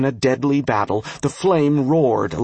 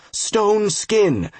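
shouted spell; 8 semitones higher than usual. A narrator's reading. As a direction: call out the spell name, pitched well up. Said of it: "he shouted".

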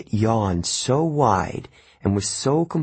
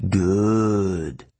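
murmured; 2 semitones lower than usual. A narrator's reading. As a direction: savour the word slowly. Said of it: "it murmured".

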